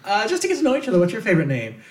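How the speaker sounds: high, bright, eager small talk; chirpy new acquaintance voice; bubbly stranger. Pretend. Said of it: "be like".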